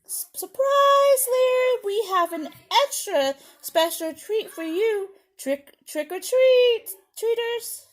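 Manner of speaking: high, loud, festive call; excited surprise. Acted. Monsters spring a Halloween treat on Larry, excited shout. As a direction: sing it out like a surprise party.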